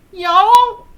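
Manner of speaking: hammed-up Southern Belle y'all; Southern Belle accent. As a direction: belt out a mock Southern y'all. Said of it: "the Southern Belle accent".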